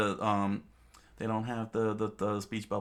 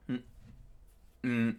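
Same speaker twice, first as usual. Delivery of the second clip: ordinary delivery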